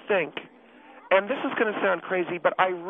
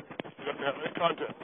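whispering on the phone; 3 semitones lower, 9 dB quieter than usual. Acted. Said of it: "Why are you whispering?".

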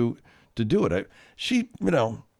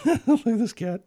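high, loud laughing words; delighted laughing; 7 dB louder than usual. Real, not acted. Laughing at the video.

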